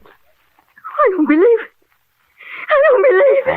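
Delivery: high, stunned gasp of disbelief; can't take in the news; shocked disbelief. Acted. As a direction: voice rising in shock. Nurse shocked by murder news.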